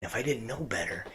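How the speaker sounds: usual speaking voice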